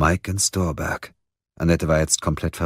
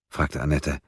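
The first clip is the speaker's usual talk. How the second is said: ordinary delivery, nothing marked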